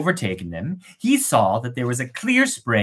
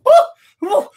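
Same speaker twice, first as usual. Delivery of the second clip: very high loud startled cry; startled maiden; 8 dB louder than usual. Acted. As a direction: gasp in shock at being watched.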